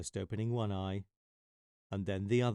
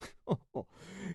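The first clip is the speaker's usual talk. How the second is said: lion giggle; 9 semitones higher, 5 dB quieter than usual. Acted. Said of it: "he even giggled".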